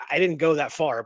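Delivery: normal talking voice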